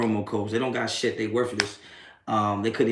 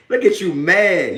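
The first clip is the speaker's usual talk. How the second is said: louder, slow taunt; slow mocking taunt; 6 semitones higher, 6 dB louder, slower than usual. Real, not acted. Taunting a troll who seems mad, slow mockery. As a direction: drag it out to mock him.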